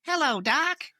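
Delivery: put-on high-pitched Southern boy alter; put-on falsetto Southern boy voice. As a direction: greet them in a high, camp Southern drawl.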